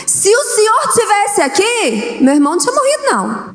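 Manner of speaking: Martha's high, accusing plea to Jesus, trailing off; reproachful Martha. Retold or imitated. Martha reproaching Jesus for arriving too late, with high accusing pitch. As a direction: say it like a hurt demand, lifting the pitch. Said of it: "Ela tá cobrando algo de Jesus".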